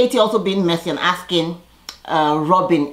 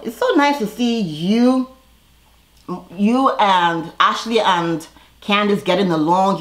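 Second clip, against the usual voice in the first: mock sweet; 3 semitones higher, slower than usual. Mocking Giselle's fake sweetness.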